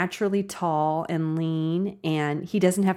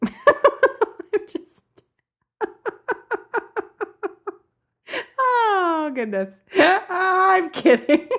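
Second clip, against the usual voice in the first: real helpless laughter, pitched way up; high, stretched-out words through laughter; laughing hard; 14 semitones higher, 4 dB louder, much slower than usual. Real, not acted. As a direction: crack up at your own joke while talking. Cracking up at own joke.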